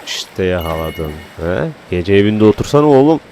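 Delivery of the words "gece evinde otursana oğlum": loud mock-scolding rant. Real, not acted. Gamer chiding night swimmers, loud mock scolding. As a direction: chide the swimmers loudly.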